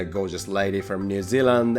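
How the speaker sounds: ordinary talk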